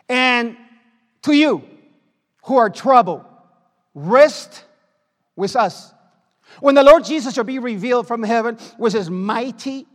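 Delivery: loud, slow, thundering scripture; a preacher proclaiming judgment to the congregation, loud and slow; preaching at full force. Acted. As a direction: boom the verse out like a pulpit preacher.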